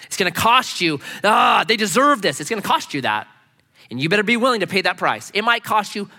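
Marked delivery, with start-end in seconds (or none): 1.2-2.4 louder, higher outburst of resentment; acted indignant protest; mock vengeful anger. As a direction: spit out the grudge, worked up. Acts the wish for revenge.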